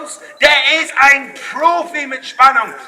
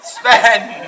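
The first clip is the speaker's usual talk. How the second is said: ordinary delivery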